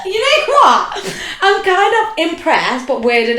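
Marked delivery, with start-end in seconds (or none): 0.0-1.9 high and loud, slow through a laugh; cracking up in amazement. Real, not acted. Amazed at her co-host knowing the lyrics, laughing.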